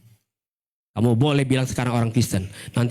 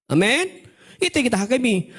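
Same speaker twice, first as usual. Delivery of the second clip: usual speaking voice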